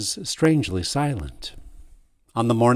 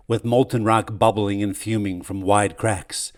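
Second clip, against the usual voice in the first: usual speaking voice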